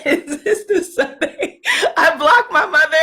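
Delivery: talking through laughter; laughing. Real, not acted. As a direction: crack up while getting the words out. Laughing at mom's live comments.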